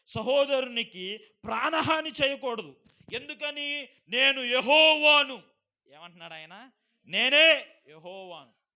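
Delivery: loud, slow, high proclaiming of scripture; full force preaching. Acted. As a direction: thunder the verse at the congregation. (గట్టిగా నెమ్మదిగా ప్రసంగం)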